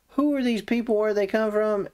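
high-pitched mock interrogation; questioning prophet. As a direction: lightly act out a prophet demanding answers. Acts out Isaiah questioning Hezekiah.

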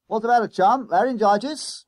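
high, booming chummy conductor; loud bus conductor. A narrator's reading. Conductor teasing a croaking schoolboy, loud and chummy. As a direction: quiz him loudly, pitched right up.

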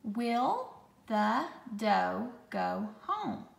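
slow, word-by-word dictation; slow, clear dictation voice; dictation pace. Shown as a demonstration. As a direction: read it out slowly for spelling. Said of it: "Let me read it again".

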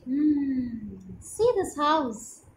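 plain reading voice

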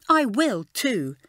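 slow, eager mild exclamation; cheered up, say it with new keenness; a light happy exclaim. A narrator's reading. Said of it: "she exclaimed".

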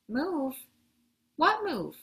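high, startled questions; shocked disbelief. A narrator's reading. Postman hears the family is moving, shocked disbelief. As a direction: blurt it out in alarmed surprise.

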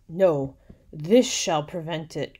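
loud, drawn-out cry; tyrant's cry. A narrator's reading. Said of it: "cried the tyrant".

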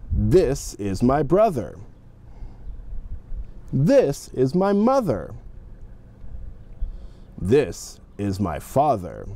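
this is my brother, said slowly and clearly; slow model sentences. Shown as a demonstration. Teacher models slow sentences for the class. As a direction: speak each sentence slowly for repetition. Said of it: "please repeat after me".

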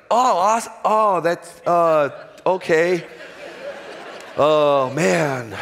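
high, loud put-on dismay with laughter; mock fake disappointment. Pitcher to the GM who traded him, mock fake-sad high voice. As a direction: fake sorrow while laughing inside. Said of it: "And I was like".

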